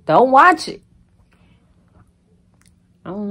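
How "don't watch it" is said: loud, high snap at the haters; emphatic dismissal. Real, not acted. Telling off haters who watch anyway, loud and high. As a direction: shout it as a blunt dismissal.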